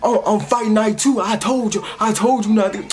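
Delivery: a fed-up dad saying I told you so; exasperated dad. Retold or imitated. Dad on the phone scolding his daughter, lightly voiced exasperation. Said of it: "He like".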